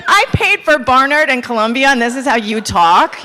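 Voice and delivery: high, loud imitation of an indignant mother; loud mock maternal outrage; scolding mom. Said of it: "She's like".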